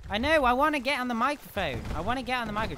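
high, loud and eager; eager near-yell; excited shout. Real, not acted. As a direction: blurt it out with excitement.

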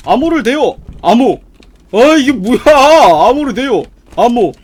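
loud, exaggerated re-enactment in disbelief; mock password shouting. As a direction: yell both sides of the silly exchange. Re-enacts password exchange in shock. (황당해하며 크게 흉내 내기)

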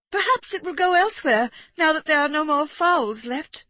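high-pitched wife trying to deflect; Amanda suggesting. A narrator's reading. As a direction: hopefully nudge him off the idea. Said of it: "suggested Amanda".

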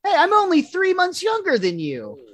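cranky, high-pitched protest; Milhouse. Acted. Milhouse to Bart about his age, cranky high whine. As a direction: pipe up high, indignant at being called young. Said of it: "you're getting cranky".

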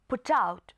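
"put out" as one word, "pu-tout"; put out linked. Shown as a demonstration. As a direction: link the T of "put" into "out". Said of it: "ลากเสียง T มาหา O ด้วย". (อ่าน "put out" เชื่อมเสียงเป็นคำเดียว)

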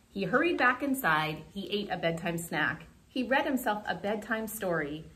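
ordinary delivery, nothing marked

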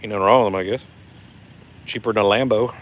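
normal talking voice

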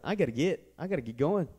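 lightly Southern "I gotta get going". Shown as a demonstration. Preacher repeats the angel's line the way they say it down south, lightly Southern. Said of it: "is how we say it down south".

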